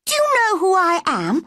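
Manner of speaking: put-on high, commanding boy's voice; imperious boy. A narrator's reading. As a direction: challenge the old man as a haughty boy. Boy confronts the gardener.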